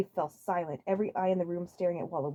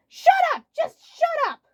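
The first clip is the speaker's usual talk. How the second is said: very high loud squeaky "shut up"; shrill squeal; 10 dB louder, slower than usual. Acted. Waluigi twisting Wario's words, very high squeal. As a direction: squeak it out like a cartoon.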